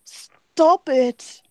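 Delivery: high, hammed-up whimpering plea. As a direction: whimper in a shaky British accent attempt. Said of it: "a small voice whimper".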